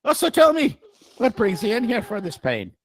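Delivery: high, lightly voiced Irish lilt; mock Irish accent. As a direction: chatter up high in a hammed-up Irish voice. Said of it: "talking back to him like that".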